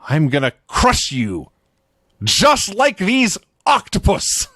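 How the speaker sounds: slow mock wrestler's vow, rising high at the end; put-on promo voice, building to a big finish; imitating Crush. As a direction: deliver a hammed-up threat, drawn out word by word. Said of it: "And he vows".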